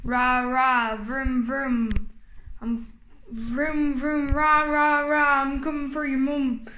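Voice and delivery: low, menacing monster. Acted. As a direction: rumble it like a big beast. Toy monster voice.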